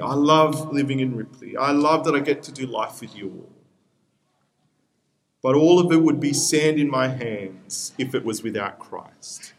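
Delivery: normal talking voice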